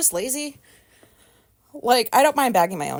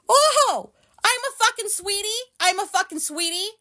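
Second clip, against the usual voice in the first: much higher, indignant repetition; outraged disbelief; 9 semitones higher than usual. Real, not acted. Vlogger fuming at being called sweetie by a clerk. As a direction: spit back the insult in disbelief.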